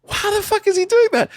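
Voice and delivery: imitating his own shock as his son pulls away; dad losing a beach race, high disbelieving cry; stunned disbelief. Watching son outrun him.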